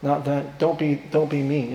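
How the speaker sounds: soft, meek plea; mild, hurt reproach. Acted. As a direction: chide them very gently and quietly. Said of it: "He felt bad and said".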